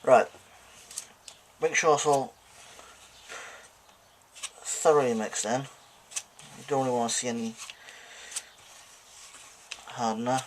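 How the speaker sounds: muffled through a respirator mask. Real, not acted. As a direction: talk muffled behind a mask. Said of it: "Sorry if it sounds a little bit muffled now".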